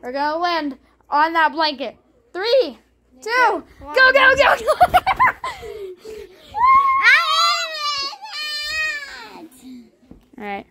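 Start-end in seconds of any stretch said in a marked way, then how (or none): 2.3-4.6 building from loud to very loud and high; excited countdown. Real, not acted. 4.6-9.8 high shrieking laugh; screaming laughter. Real, not acted. Laughing after the jump.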